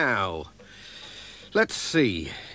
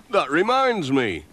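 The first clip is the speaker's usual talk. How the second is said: ordinary delivery, nothing marked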